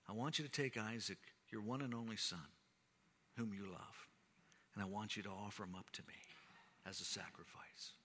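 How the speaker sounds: low, hushed and intimate, sinking to a whisper; soft voice of God. Acted. God asks Abraham for Isaac in a hushed, whispered voice. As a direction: let God's request fall almost to a whisper.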